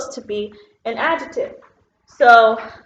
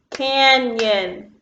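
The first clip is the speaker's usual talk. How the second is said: "Canyon" stretched into two beats, can-yon; split into syllables. Shown as a demonstration. Teacher clapping syllables with pupils, "Canyon" in two separate beats. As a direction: clap out "Canyon", one syllable at a time. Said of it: "clap with me on the syllables".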